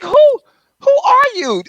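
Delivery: very high, slow disbelieving voice; incredulous. Retold or imitated. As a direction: act stunned and offended. Arguing with his mama.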